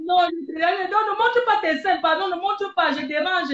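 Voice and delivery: loud teasing protest, high; laughing shout. Real, not acted. (crier en taquinant)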